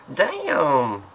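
high, loud American exclamation; exaggerated American drawl, falling; American accent. Shown as a demonstration. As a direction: belt out a drawn-out American damn. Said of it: "make sure I do this good".

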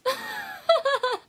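high, loud giggle burst; high burst of laughter. Real, not acted. Laughing at own pain.

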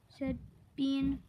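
plain reading voice

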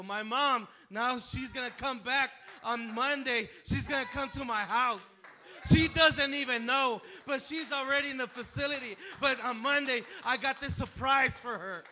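loud, high, beaming with joy; excitedly telling the church; joyful news. Real, not acted. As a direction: share the good news with delight. Joyful announcement of mother's recovery.

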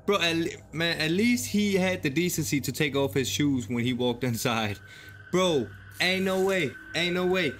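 usual speaking voice

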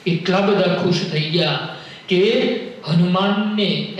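"એટલા બધા ખુશ થઈ ગયા" drawn out slowly, each word weighed; slow it down to savour the joy. Shown as a demonstration. Preacher dwelling on Rama's joy for the audience, slowly. Phrase repeated slower.

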